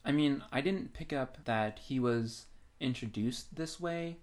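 usual speaking voice

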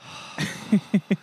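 long, heavy, fed-up sigh; sigh, fed up with waiting; exasperated sigh. Retold or imitated. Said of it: "He's like".

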